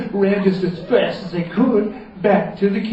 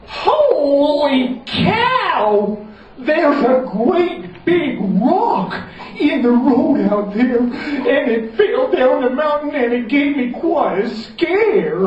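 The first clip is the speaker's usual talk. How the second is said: higher, slow, hammed-up alarm; alarmed Common John; 9 semitones higher, 3 dB louder, slower than usual. Common John warns of the rock in the road, hammed-up alarm. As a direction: sell the scare to the children.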